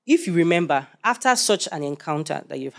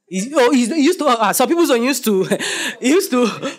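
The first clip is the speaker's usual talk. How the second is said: laughing; 7 semitones higher, 4 dB louder than usual. Real, not acted. Laughing while gesturing graph.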